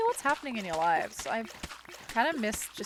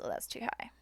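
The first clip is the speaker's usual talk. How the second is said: whispered aside; 12 dB quieter than usual. Real, not acted. Remark to herself.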